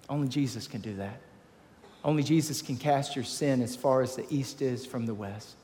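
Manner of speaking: ordinary talk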